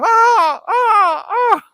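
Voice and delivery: very high, loud imitated crow screeches; piercing mock bird screams; crow screaming. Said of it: "and it was screaming".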